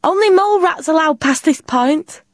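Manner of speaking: high, officious little guard; a lightly voiced guard blocking the way; mole rat guard. A narrator's reading. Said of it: "the mole rat guard said".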